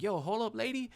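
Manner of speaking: very high-pitched objection; indignant. Retold or imitated. Patient stopping the negative specialist, indignant. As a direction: cut her off, voice shooting up.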